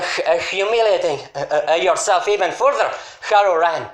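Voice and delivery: ordinary talk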